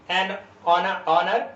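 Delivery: एन ऑनर drawn out slowly; teacher modelling it slowly; slow demo. Shown as a demonstration. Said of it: "slow demo of an before honour".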